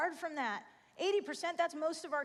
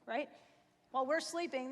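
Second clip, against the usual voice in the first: ordinary talk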